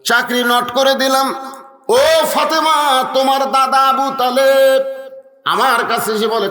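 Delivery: high, intoned sermon storytelling; impassioned, high-pitched preacher's narration; preach. Acted. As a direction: call out the name and chant the tale onward.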